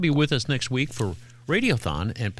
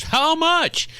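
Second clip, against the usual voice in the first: pushy client; 4 dB louder than usual. Retold or imitated. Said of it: "He goes".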